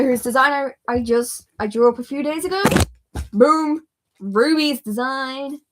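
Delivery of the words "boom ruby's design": excited boom. Real, not acted. Reveals drawing of Ruby.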